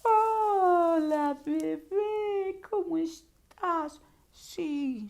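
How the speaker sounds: put-on high, drawn-out baby-talk cooing; syrupy, girlish phone voice for a lover; lovey-dovey. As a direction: coo sweetly to a sweetheart on the phone. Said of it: "ante los estados emocionales hace esos cambios".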